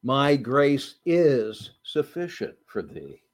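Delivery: slow reading, is held and stressed. Shown as a demonstration. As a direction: lean on the word is. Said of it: "And the word "is".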